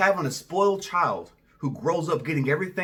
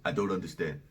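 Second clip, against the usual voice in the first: soft, low imitation of an African accent; attempted African accent; 11 semitones lower, 8 dB quieter, faster than usual. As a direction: try an African man's accent, quietly. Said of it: "I'm gonna try to do his accent".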